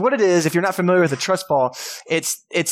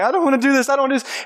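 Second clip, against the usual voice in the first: high, whiny scared camper; mock terrified whine; 11 semitones higher, much faster than usual. Youth pastor voicing a scared camper, high whiny plea. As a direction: imitate a frightened kid refusing to fall.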